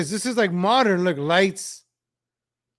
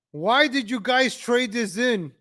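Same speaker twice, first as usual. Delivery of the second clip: ordinary delivery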